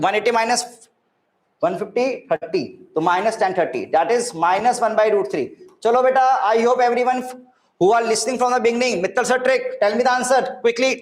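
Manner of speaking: ordinary talk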